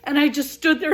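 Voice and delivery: ordinary delivery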